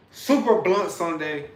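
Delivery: slow, loud hype announcement; show intro. Acted. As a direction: announce the show title big. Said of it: "Y'all know what time it is".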